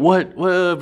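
high, loud double what; imitating baffled players; mock disbelief. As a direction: bark it out in confusion. People reacting in disbelief.

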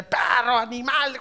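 hammed-up scolding of a dog; yelling at dog. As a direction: imitate venting on the dog, ending in a growl. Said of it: "grítele".

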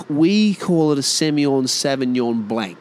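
Aussie "savvin-yon blank"; Aussie accent. Acted. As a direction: insist on the Aussie way of saying Sauvignon Blanc. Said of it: "that's our accent".

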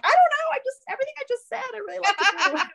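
high, fast, laughing I-don't-know; retelling her own flustered reply to the coach, high and laughing; squeaky flustered self. Retold or imitated. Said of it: "and I'm like".

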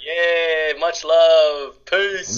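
high, put-on cheery farewell; celebratory high-pitched goodbye; playful sign-off voice. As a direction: sign off in a drawn-out, high hype voice. Signing off in his character voice.

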